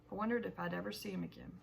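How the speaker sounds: usual speaking voice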